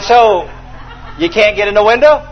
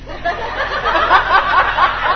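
high laugh; 10 semitones higher, 3 dB quieter than usual. Real, not acted. Preacher laughs at scene.

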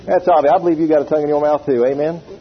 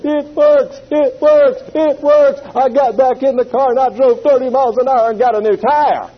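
much higher, louder cry; joyful exclamation; 8 semitones higher, 4 dB louder than usual. Retold or imitated. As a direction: shout it in triumph. Exclaimed in joy.

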